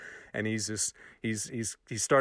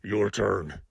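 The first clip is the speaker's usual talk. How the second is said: low, curt drill sergeant voice; a lightly voiced sergeant handing down the order; 5 semitones lower than usual. Drill sergeant to recruits in the gas chamber, low and curt. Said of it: "he turns to us and says".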